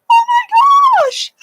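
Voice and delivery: very high, loud excited squeal. Acted. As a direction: shriek it out, far above normal pitch. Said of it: "And loud".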